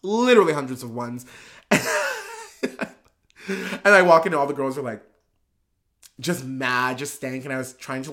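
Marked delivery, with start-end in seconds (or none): 1.7-2.9 a high burst of laughter. Real, not acted. Recalling the hundreds of ones, a high laugh. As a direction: laugh at the memory.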